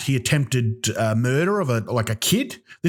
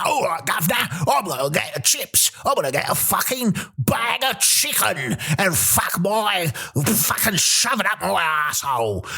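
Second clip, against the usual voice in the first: hammed-up English accent; 6 semitones higher than usual. Comedian mimicking another English town, hollered English accent. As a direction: holler it like a caricature English local. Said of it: "you go to another place".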